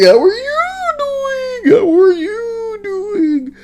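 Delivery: very high, slow, whiny "How are you doing?"; silly squeaky repeat. Retold or imitated. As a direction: whine it over and over to annoy. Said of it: "And you were like".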